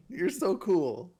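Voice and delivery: high, slow doggy praise; praising a dog. Acted. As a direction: coo it slowly like petting a dog.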